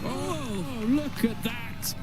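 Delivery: high, soft, amazed call; surprised gasp. Real, not acted. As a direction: marvel at the shot. Reaction to a shot.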